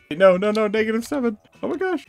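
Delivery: loud, climbing cries of alarm; panicked shouting. Real, not acted. Player answering a sum while chased, panicked shouting.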